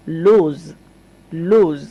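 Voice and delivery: লুজ with a u sound; লুজ said twice with u vowel; model word. Shown as a demonstration. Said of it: "উচ্চারণ করছি উ এর মতো".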